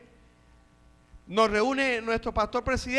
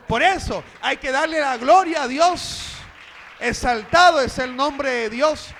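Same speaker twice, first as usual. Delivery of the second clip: loud, raised, driving sermon delivery; fervent church preaching, higher and louder; full-force preaching; 3 semitones higher, 7 dB louder than usual. Real, not acted. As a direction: exhort the congregation at full volume.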